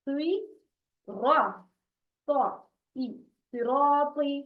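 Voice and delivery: heavy rounded "र", heavy "सौ", each separate. Shown as a demonstration. Teacher spelling out sounds, thick "र" and "सौ". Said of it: "बहुत मोटा और ऊंचा".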